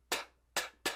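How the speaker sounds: soft "t" puffs with no voice; voiceless T. Shown as a demonstration. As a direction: say "t" alone, only air. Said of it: "O som do T, não". ("t" não vozeado, só o ar)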